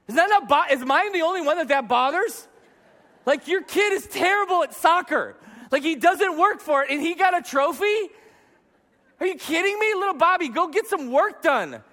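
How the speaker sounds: high-pitched, indignant disbelief; exasperated rant. Acted. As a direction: rant like a parent driven nuts. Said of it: "It drives me nuts".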